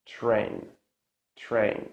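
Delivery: "train" opening almost like "chrain". Shown as a demonstration. Teacher repeats "train" for learners, ch-like T. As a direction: slide T and R in "train" into a ch sound. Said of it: "sounds almost like ch".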